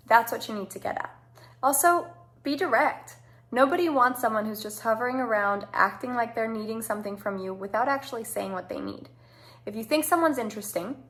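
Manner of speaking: ordinary talk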